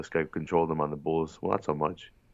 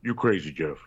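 horrible celebrity impression, only lightly put on; Shaq; 2 semitones lower than usual. Co-host repeating his tease, a hint of Shaq impression. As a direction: do a half-convincing basketball star voice. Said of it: "That was a horrible Shaq impression".

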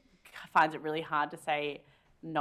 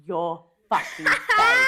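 mock angry; 2 semitones higher, 9 dB louder, much slower than usual. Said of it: "she was like".

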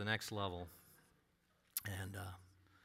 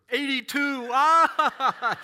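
high, loud laughing eighty-two; loud mocking cackle at the number; 12 semitones higher, 14 dB louder, much slower than usual. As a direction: burst out laughing at the score. Man reading his score, laughing.

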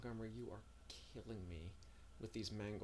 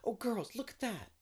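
high-pitched cry of delight; excited discovery; 14 semitones higher, 9 dB louder than usual. A narrator's reading. Anne showing the girls a woodland pool, excited. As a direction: call the others to look, voice leaping up. Anne calls friends to look.

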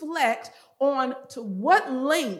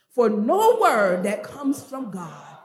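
"no word" loud and drawn out, falling away to "God"; emphatic recitation; 8 dB louder, slower than usual. Shown as a demonstration. Preacher leading the congregation's recitation, "no word" loud and stretched. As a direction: lean hard and long on "no word". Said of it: "put emphasis on".

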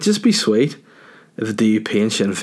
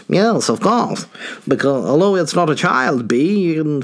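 high, put-on alien voice; 6 semitones higher than usual. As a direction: pitch it up as an obliging alien. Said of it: "the aliens will probably go".

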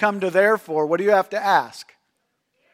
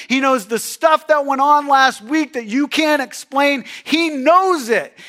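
louder, higher preaching build; fervent preaching; 6 semitones higher, 4 dB louder than usual. Real, not acted. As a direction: preach it out with full force. Preaching at full force.

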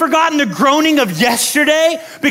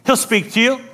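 usual speaking voice

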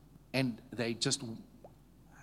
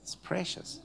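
hushed tender; 3 semitones higher, 8 dB quieter than usual. Real, not acted. Quiet room, tender remark.

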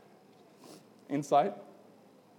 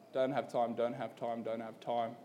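dismissive brush-off; 6 semitones lower, 8 dB quieter than usual. Retold or imitated. Repeated dismissive refusals to mum.

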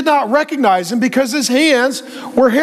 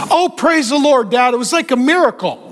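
put-on overeager church-boy gushing, often higher; mock spiritual talk; 4 semitones higher than usual. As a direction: cover the lie with hammed-up praise. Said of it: "he starts talking very spiritually".

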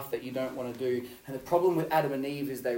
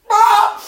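sudden high yelp, much louder; 19 dB louder than usual. Retold or imitated. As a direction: blurt out a loud, high "Ah!". Classmate's outburst in class.

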